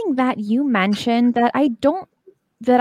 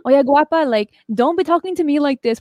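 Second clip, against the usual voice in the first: put-on higher scolding mom with thick Spanish accent; mom's thick Spanish accent; 4 semitones higher than usual. Mom scolding her daughter, imitated thick Spanish accent. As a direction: imitate a Spanish-accented mother telling you off. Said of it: "with her really thick accent".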